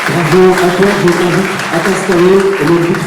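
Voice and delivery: high, loud emcee praise; loud congratulations. Real, not acted. Host congratulating the winner before the audience, loud and high. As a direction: cheer her on over the hall.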